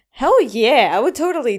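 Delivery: loud enthusiastic cheer; excited. Real, not acted. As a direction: shout it with glee. Eager answer to the quiz.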